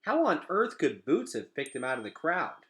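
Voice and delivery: ordinary delivery, nothing marked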